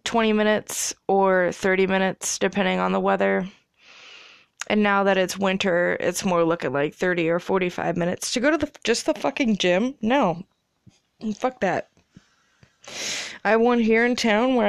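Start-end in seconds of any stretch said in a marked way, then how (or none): none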